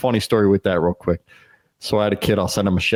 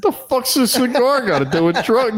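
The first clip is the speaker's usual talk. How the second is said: mock outrage. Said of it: "I'm like".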